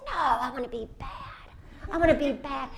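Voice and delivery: high, loud little boy chant, wanna be bad; a two-year-old insisting he wants to be bad; toddler insisting. Mother imitates her small son insisting loudly. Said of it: "He goes".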